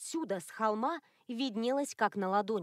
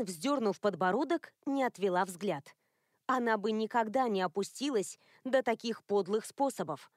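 plain reading voice

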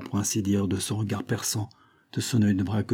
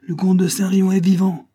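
high-pitched, shouted protest; exclaimed at full voice; loud cry; 10 semitones higher, 8 dB louder than usual. A narrator's reading. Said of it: "cria Bouche en cœur".

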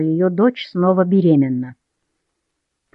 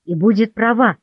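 ordinary delivery, nothing marked

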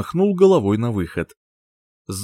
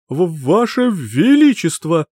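slow, high, stammering panic; stuttering in fear; 6 semitones higher, 4 dB louder, slower than usual. Acted. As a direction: stammer out the title in terror. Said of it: "заикался Гуннар".

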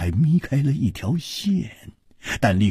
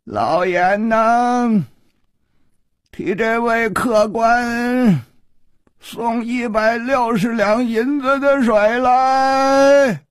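high, slow sing-song holler; long-drawn waiter's call, rising and loud; 14 semitones higher, 5 dB louder, slower than usual. A narrator's reading. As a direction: stretch the order out across the room. Shopkeeper calls order across room.

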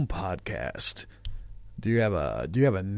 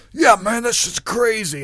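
high, loud, worked-up outburst; mock-manic agreement; acting crazy; 10 semitones higher, 7 dB louder, slower than usual. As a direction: play the wild-eyed believer, much higher and louder. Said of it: "I'm just acting crazy".

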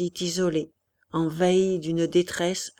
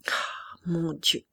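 slow whispered moan; muffled lament; 4 dB quieter, slower than usual. Acted. Feverish colonel laments the surrender, slow whisper. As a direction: breathe it out, slow, drained. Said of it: "cette lamentation sourde".

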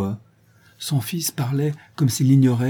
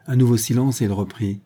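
ordinary delivery

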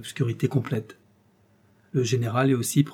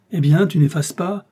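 louder, higher impatient call; impatient shout; 5 semitones higher, 6 dB louder than usual. Acted. General shouts at Ossip at the window, impatient. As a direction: demand it across the room. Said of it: "Alors il cria aussi".

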